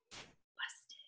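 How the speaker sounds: soft unvoiced whisper; caught, a bare whisper; whispered busted. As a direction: breathe it out as they're caught. Caught by police.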